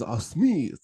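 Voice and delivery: a hint of Japanese accent on Smith; mock Japanese accent. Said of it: "that was his point".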